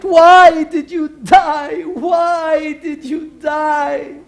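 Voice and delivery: hammed-up graveside lament; mock wailing.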